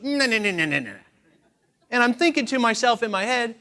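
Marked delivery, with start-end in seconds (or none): 0.0-1.0 high, loud mimicry of husband's tone; awful mocking imitation. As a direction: parrot it back as exaggerated nagging. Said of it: "And that sounds awful".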